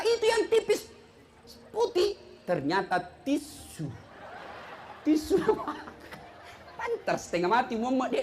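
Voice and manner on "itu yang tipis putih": higher, insistent reply; insistent father. Retold or imitated. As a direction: push the answer up high.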